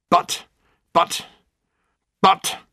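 rising, sputtering protest; frustrated. Acted. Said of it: "thumping the table in frustration".

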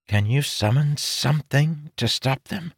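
ordinary delivery, nothing marked

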